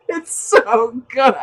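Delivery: real helpless, high laughter through the words; laughing to tears. Real, not acted. Delighted by scaring squirrels, laughing till it makes them cry. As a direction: barely get it out for laughing. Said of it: "It makes me cry".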